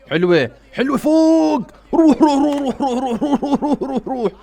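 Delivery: loud, high, rapid-fire cheering; excited urging shout. Real, not acted. Fan urging his player to go, excited high shouting. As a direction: yell them forward, voice way up.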